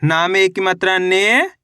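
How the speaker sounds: slow classroom sounding out, syllable by syllable; patient teacher reading to children; syllable drill. Acted. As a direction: spell each letter, then blend the word.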